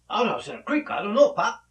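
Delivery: put-on a touch of puzzled boy answering his pop; Italian boy. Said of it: "The boy said".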